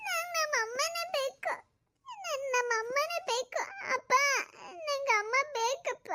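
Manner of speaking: put-on high, slow sobbing little boy pleading; small son begging his father, in tears; crying child insisting. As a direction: wail that you want your mother.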